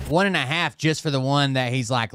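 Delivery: usual speaking voice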